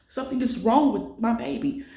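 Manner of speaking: begging them to listen, near tears; retold desperate cry. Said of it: "I'm crying".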